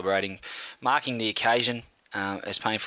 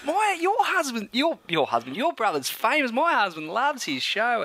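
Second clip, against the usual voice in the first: gushing friend; 11 semitones higher than usual. Retold or imitated. Sister's friend bragging about husband, high mock gush. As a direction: ham up an excited fan's wife. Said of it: "sit there and go".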